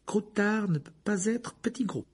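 high, tremulous reasoning voice; trembling, trying for control. A narrator's reading. Hermione tries to reason with Lupin, voice trembling. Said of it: "d'une voix tremblante qu'elle s'efforçait de maîtriser".

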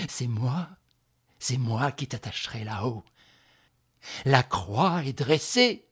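slow, rasping outpouring of hate; hoarse, jerky hatred. Acted. Woman spits fifteen years of hate, hoarse and slow. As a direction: drag out each phrase hoarsely. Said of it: "d'une voix rauque et saccadée".

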